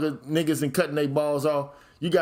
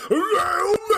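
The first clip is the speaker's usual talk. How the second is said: high, drawn-out mocking cry; hammed-up high-pitched holler; mock wailing shout; 14 semitones higher, 3 dB louder, slower than usual. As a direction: wail it high, stretching every word. Mocking demand to be manly.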